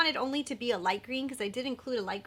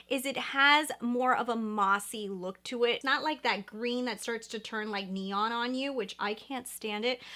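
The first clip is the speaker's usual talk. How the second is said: normal talking voice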